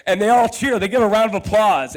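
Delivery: usual speaking voice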